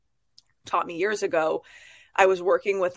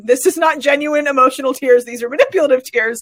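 higher, louder retold accusation; emphatic calling-out; 6 semitones higher, 7 dB louder than usual. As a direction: firmly call out a fake, punching each word. Retold calling out of fake tears.

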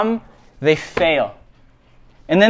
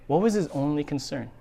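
much softer, pointed question; hushed repeated question; 3 semitones lower, 10 dB quieter than usual. Shown as a demonstration. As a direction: let the question sink in quietly.

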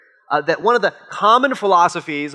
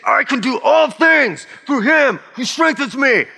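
shouted, high, pumped-up quote; fired-up pep talk; 7 semitones higher, 3 dB louder than usual. Retold or imitated. Preacher mimicking a pumped-up athlete, shouting. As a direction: psych yourself up before the big game. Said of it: "You think, ... You're getting fired up".

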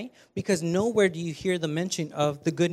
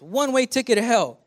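higher, louder hammed-up announcer; mock game-show announcer; 5 semitones higher, 5 dB louder than usual. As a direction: read the scratch card like an announcer. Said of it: "It says".

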